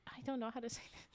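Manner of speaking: usual speaking voice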